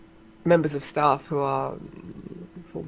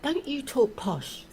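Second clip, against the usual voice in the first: high, slow mocking taunt; mocking posh accusation; 9 semitones higher, 4 dB quieter, slower than usual. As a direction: mimic the school bullies teasing. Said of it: "people used to take the mickey out of me".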